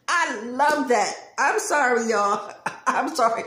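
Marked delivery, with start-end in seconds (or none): none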